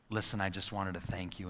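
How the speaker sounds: low, soft, humble thanks; changed, calmer demeanor. Retold or imitated. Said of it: "my demeanor changes in that minute".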